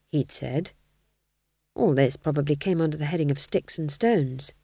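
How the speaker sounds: ordinary delivery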